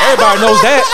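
loud, high, laughing; laughing shout. Real, not acted. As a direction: yell it through laughter. Shouting film line laughing.

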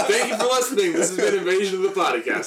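laughing, loud and high; cracking up while signing off. Real, not acted. Mock sign-off while laughing.

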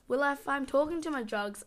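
ordinary delivery

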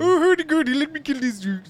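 high, loud mock Swedish Chef voice; terrible Swedish. Podcaster mocking a Swedish character, hammed-up bad Swedish accent. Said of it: "That was terrible Swedish".

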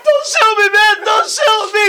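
high shouted pleading, hammed-up fear; mock crying plea. Imitating himself begging cops not to shoot, high mock cry. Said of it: "I'll be crying".

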